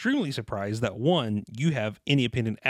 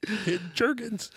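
higher laughing mom; 10 semitones higher than usual. Acted. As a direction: gasp it like a shocked mom. Mom voice continued.

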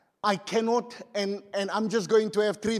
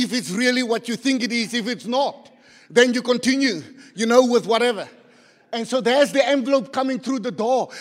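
loud, raised-pitch preacher delivery; full-force preaching; 5 semitones higher, 10 dB louder than usual. Real, not acted. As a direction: belt the story out to the congregation. Preacher fires up the congregation.

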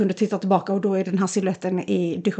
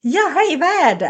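bright, much higher greeting; very cheerful; 6 semitones higher, 4 dB louder than usual. Retold or imitated. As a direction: sound eerily happy from the cellar. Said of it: "jätteglatt". (överdrivet glad)

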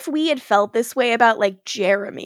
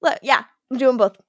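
normal talking voice

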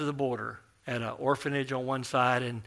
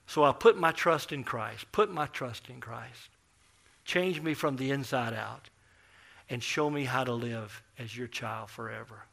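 leading a prayer; 4 dB quieter than usual. Acted.

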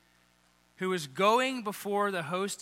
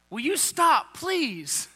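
exasperated plea; 7 semitones higher, much slower than usual. Retold or imitated. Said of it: "like, they're angry".

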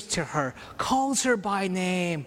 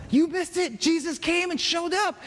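high-pitched, eager news-breaking; excited telling; 9 semitones higher than usual. Retold or imitated. Disciples telling absent Thomas, excited and high.

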